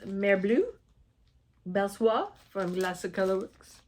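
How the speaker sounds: French names in an amateur French accent; bad French accent. Real, not acted. Vlogger reading French thread names, clumsy French accent. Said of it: "I apologize for my bad accent".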